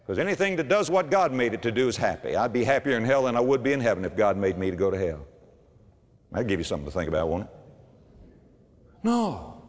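ordinary talk, nothing marked

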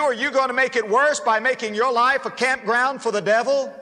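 loud, high preacher's exhortation; preaching at full force. Real, not acted. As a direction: drive it home to the congregation.

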